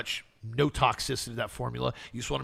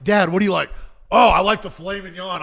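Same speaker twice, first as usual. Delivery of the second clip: loud, higher-pitched retold table talk; mock loud exchange; 7 semitones higher, 10 dB louder than usual. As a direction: act out the restaurant exchange at high volume. Retold restaurant exchange blinded by flashlight.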